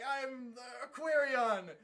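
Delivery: drawn-out, loud name call; hesitate, then announce it slowly and big; mock heroic proclamation. Hero says the forbidden word, mock proclamation.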